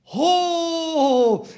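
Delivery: loud, high showman's gasp; mock surprise. Magician wowing the TV audience, loud high gasp. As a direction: sell the reveal.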